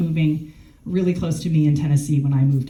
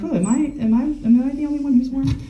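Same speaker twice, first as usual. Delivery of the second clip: higher, quick, half-laughing; playful question; 8 semitones higher, faster than usual. Real, not acted. Asking the audience if they are warm, lightly amused. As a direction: tease the audience, light and high. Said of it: "It's a little warm".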